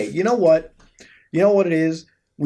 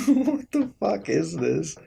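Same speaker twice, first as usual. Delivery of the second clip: slow laughing question, a little softer; laughing disbelief; 4 semitones higher, 4 dB quieter, much slower than usual. Real, not acted. Vlogger laughing at a bizarre website, amused.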